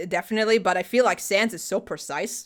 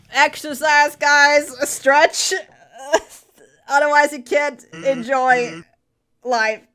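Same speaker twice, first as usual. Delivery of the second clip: laughing through pain; 6 semitones higher, 4 dB louder, slower than usual. Real, not acted. Laughing advice while hurting.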